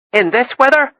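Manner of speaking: light Norfolk lilt, sung up high; a girl's cheerful Norfolk sing-song; lightly voiced Norfolk girl; Norfolk accent. A narrator's reading. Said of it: "Jenny sang in her heavy Norfolk accent".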